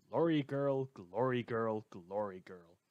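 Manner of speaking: name echoing and fading away. Acted. Radio host's name echo fading out.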